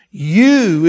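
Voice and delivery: You loud and drawn out; stress on You. Shown as a demonstration. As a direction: lean long and hard on "You". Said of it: "this is the emphasis here now".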